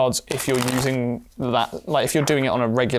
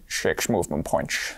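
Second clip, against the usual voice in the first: slow, low, soft Scottish voice; Sean Connery impression; 9 semitones lower, 5 dB quieter, slower than usual. Player chipping in on the move, still in Scottish character. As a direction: slur the S sounds in a low burr. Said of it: "I don't know if I can stop".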